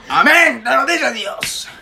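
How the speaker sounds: mock preacher. Exclamación de predicador burlón.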